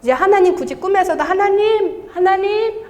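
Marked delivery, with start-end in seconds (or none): 1.2-2.8 high, drawn-out imitated call; calling up to God. Testifier imitating her old searching prayers, calling out to God. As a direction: cry out skyward, pleading for attention. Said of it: "이렇게 하늘 보면서".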